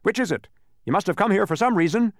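high, pressing questioning; impatient. A narrator's reading. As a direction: hurry them to answer. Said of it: "repeated the gateman impatiently".

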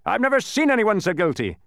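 high, loud officious accusation; accusing policeman. A narrator's reading. Officer reaching Milo with a verdict, loud accusation. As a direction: pronounce guilt loudly and officiously.

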